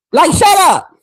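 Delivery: normal talking voice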